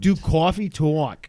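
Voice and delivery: slow, exaggerated New York vowels; Coffee Talk accent. Shown as a demonstration. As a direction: demonstrate a thick New York "cawfee tawk". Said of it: "don't do Long Island".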